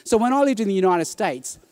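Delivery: usual speaking voice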